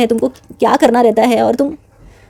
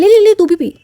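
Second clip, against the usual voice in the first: much higher, insistent wheedling; pushy drunk friend; 9 semitones higher, 3 dB louder than usual. Retold or imitated. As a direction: shove the drink at her playfully. Drunk friend pushing a drink. (नशे में ज़िद करती ऊँची आवाज़)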